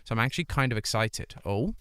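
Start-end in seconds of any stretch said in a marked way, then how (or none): none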